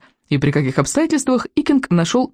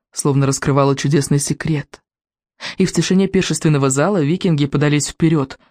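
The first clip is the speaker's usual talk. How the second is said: ordinary delivery, nothing marked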